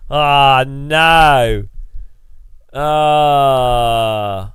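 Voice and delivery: loud stretched-out dismay. Real, not acted. Streamer realising his mistake, loud dismay. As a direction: groan out the realisation.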